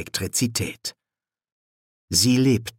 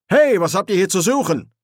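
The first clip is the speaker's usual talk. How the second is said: high, louder officer's challenge; frazzled challenge; 8 semitones higher, 5 dB louder than usual. A narrator's reading. Officer at his wits' end confronts four children, frazzled. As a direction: challenge the intruders, nerves worn thin. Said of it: "mit den Nerven am Ende war".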